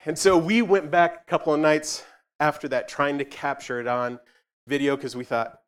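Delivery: ordinary delivery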